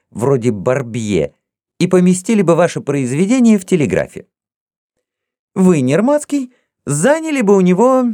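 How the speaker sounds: put-on high, teasing voice of a young princess; playful young princess. A narrator's reading. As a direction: tease each suitor in turn, lightly mocking. Zinaida teasing her suitors.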